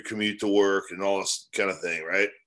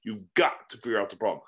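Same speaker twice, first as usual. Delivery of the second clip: ordinary talk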